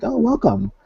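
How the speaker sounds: high, sugary mock welcome; mock welcoming. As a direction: imitate a condescending greeting. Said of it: "and like".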